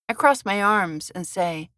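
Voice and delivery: ordinary delivery, nothing marked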